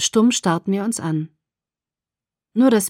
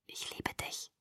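barely audible, tender whisper; 22 dB quieter than usual. A narrator's reading. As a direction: breathe it close into the ear. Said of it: "flüsterte er".

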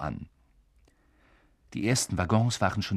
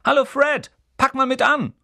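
calling out; 8 dB louder than usual. A narrator's reading. Twin calling to his brother.

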